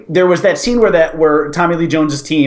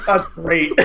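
ordinary delivery

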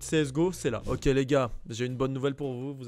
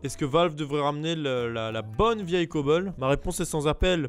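usual speaking voice

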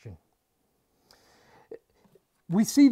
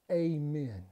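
hushed reverent amen; 12 semitones lower, 12 dB quieter, much slower than usual. Retold or imitated. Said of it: "responds to the Lamb's comments, with".

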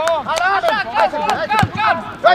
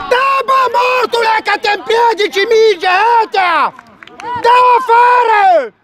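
shouted orders; slower than usual. Real, not acted. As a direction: yell it across the pitch at the players. Coach yelling orders at players.

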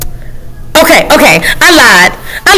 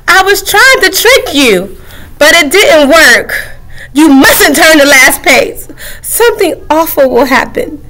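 mock distress; 7 semitones higher than usual. Book confesses, half crying.

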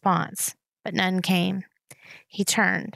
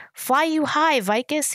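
higher-pitched, restrained yell; yelled farewell; 7 semitones higher than usual. A narrator's reading. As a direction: call out a farewell, pitch lifted. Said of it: "he yelled".

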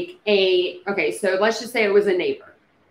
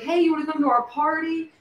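high-pitched, imagined friendly invitation; casual party invite; 8 semitones higher than usual. Acted. Imagined passer-by inviting neighbors to a party, high-pitched casual invite.

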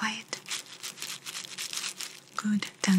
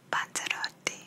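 right in your ear; 7 dB quieter than usual. Acted. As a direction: close ear whisper. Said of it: "Now I'm going to whisper in each ear".

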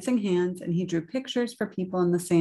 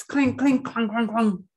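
high ringing clinks dropping to clunks; clinking swords; 5 semitones higher, 4 dB louder than usual. Acted. Knights waving swords, clanking noises. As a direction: imitate metal clinking and clunking.

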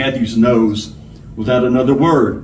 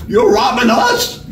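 high, drawn-out disbelief; high-pitched, incredulous victim; 10 semitones higher than usual. A narrator's reading. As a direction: stretch the question out in sheer disbelief. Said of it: "Straw Hat sounded incredulous".

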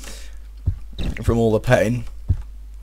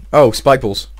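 ordinary delivery

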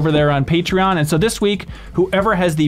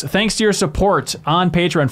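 ordinary talk

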